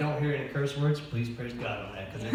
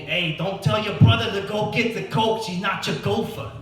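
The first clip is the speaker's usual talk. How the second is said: put-on louder, higher Boston accent, old lady warning; imitating raspy Boston grandma; 8 semitones higher, 7 dB louder than usual. Grandma warning him about his brother, Boston accent. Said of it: "she had a very raspy Boston accent".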